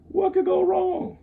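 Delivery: high, loud, drawn-out fool's voice; foolish. Acted. Class clown eagerly agreeing to the stunt, foolish. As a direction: crow it slowly like a clueless fool. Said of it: "I said like a fool".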